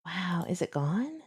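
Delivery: slow, breathless whisper to herself; hushed worry as the post vanishes. Real, not acted. Vlogger whispering to herself as a post disappears, slow whisper. Surprised while searching, hushed.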